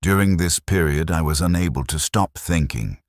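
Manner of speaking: ordinary delivery, nothing marked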